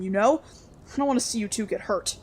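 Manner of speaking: ordinary delivery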